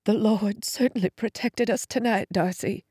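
soft quavering relief after a fright; quavery. A narrator's reading. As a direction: let the voice quaver, hushed and shaken. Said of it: "Mom's voice sounded quavery".

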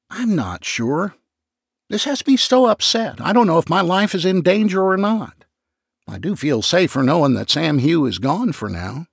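high, distressed and shaky-sounding; mildly tearful high voice; upset. Acted. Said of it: "McCracken began to sob".

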